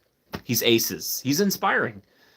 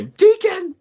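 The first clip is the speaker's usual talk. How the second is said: calling out; 7 dB louder than usual. Retold or imitated. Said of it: "or I should say".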